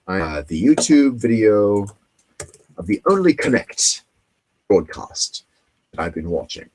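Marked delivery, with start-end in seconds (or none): 2.8-6.7 lightly put-on British accent on the show's name. Saying the show's name in a light British accent. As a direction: slip into a hint of a British accent. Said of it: "I turn into my British accent there".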